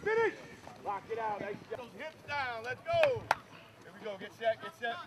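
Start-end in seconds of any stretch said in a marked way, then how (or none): 0.0-0.3 sharp high shout of Finish; shouted command. Real, not acted. Coach calling end of drill. 2.6-3.2 high yelled Let's go; shouted encouragement. Real, not acted. Coach urging players on.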